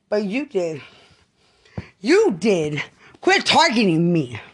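yelling her innocence at them; furious loud protest; angry shouting. Real, not acted. Continued furious rant.

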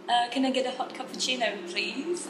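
polite British coffee-shop order; British accent. Shown as a demonstration. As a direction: order in British English, lifting the ending. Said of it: "bahasa di U K". (logat British saat memesan kopi)

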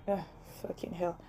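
soft muttered curse under breath. Real, not acted. Host cursing her laptop troubles, soft mutter. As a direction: swear quietly to yourself.

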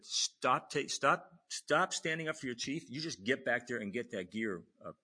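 loud, high, emphatic repeated commands; retold angry yelling. Officer stopping junior defending his chief, retold angry yelling. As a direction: imitate a superior shouting someone down. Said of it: "getting yelled at by the E".

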